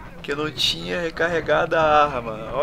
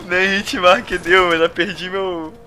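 frustrated yelling; 3 semitones higher, 8 dB louder than usual. Real, not acted. Missed shots, lost shield.

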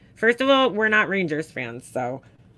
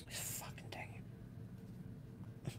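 whispered curse; 32 dB quieter than usual. Real, not acted. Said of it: "whispered curse at lost screen".

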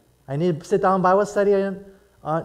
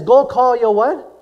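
mock question; 5 semitones higher, 8 dB louder, slower than usual. Preacher voices Jesus, questioning.

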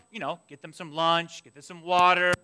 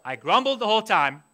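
ordinary talk

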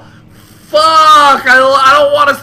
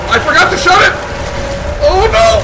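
high, loud cry of alarm; real frightened realisation; panicked; 7 semitones higher, 3 dB louder than usual. Real, not acted. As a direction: shout in rising horror. Realises mistake while chased.

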